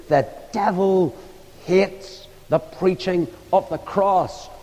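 slow imitation of a sick old woman, softening at the end; old lady's wheezing voice. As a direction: draw it out solemnly in her ailing voice. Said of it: "that old lady with her wheezing voice".

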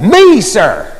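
lightly imitated eager reply; eager waitress. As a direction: pipe up as the waitress answering the call. Waitress volunteering in retold story.

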